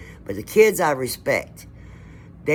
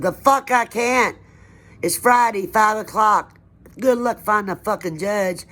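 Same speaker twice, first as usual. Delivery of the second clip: retold angry yelling; 6 semitones higher than usual. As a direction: yell it at the cop, refusing to back down. Defying the police on her doorstep.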